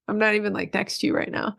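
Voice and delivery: usual speaking voice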